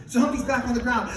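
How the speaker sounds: ordinary delivery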